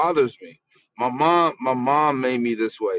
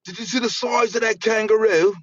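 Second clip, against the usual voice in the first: put-on higher, loose Aussie accent; mock Australian; 8 semitones higher than usual. Mocking Australian women, hint of an Australian accent. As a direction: say it like a cartoon Crocodile Hunter. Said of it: "They be like".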